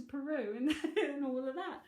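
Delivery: laughing through the words. Real, not acted. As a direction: chuckle while finishing the thought. Laughing at her own story.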